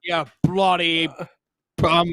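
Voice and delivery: mock Australian cursing; hammed-up Aussie insult, slowing down; Australian accent. Host guessing at Australian insults, mock Australian accent. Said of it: "whatever they say".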